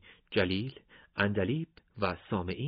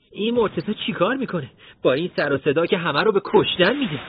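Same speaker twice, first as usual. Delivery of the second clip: high, loud exasperated complaint; fed up with the noise, complain loudly; 10 semitones higher, 8 dB louder than usual. Acted. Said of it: "بهنام کلافه شد". (کلافه و با صدای بلند)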